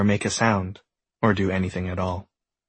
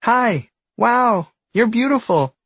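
high, loud, amazed little voice; excited tadpole; 13 semitones higher, 5 dB louder, slower than usual. A narrator's reading. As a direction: gush with wonder, much higher. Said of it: "says the tadpole".

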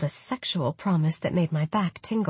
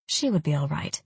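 usual speaking voice

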